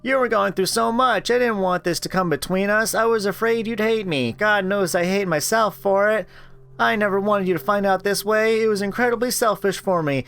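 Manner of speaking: high pleading that sinks toward the end; remorseful Anita. Acted. Anita begs Rachel's forgiveness, remorseful.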